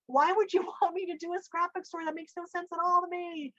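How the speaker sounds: high-pitched exasperated plea to God; baffled complaint. Retold or imitated. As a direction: plead that it is absurd.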